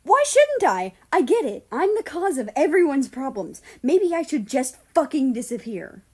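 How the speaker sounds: high, loud, self-pitying shout; yelling at her fellow Guardian mid-argument; angry outburst. Acted. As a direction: lash out, blaming herself. Heated quarrel, self-blame outburst.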